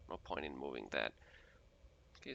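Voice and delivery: ordinary talk, nothing marked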